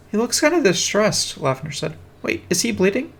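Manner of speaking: lightly voiced concerned girl; worried schoolgirl. Girl voiced, worried about rat.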